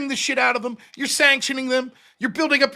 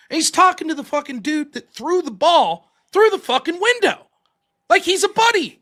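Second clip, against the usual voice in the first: ordinary delivery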